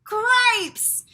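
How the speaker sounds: high, loud squeal of alarm; startled mouse cry. Acted. Furlough spotting his brother with the princess, shrieking. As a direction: yelp it in shock. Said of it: "said Furlough".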